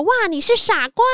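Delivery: put-on high, surprised child's outburst; high-pitched kid's exclamation; kid blurting out. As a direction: blurt it like a cheeky schoolboy. Kid blurts insult at teacher.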